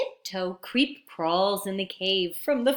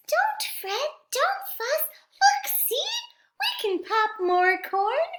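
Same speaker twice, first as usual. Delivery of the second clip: much higher soothing mouse squeak; squeaky mouse; 11 semitones higher, 4 dB louder than usual. Acted. Mouse comforting the crying bear in a high squeak. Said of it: "Mouse squeaks".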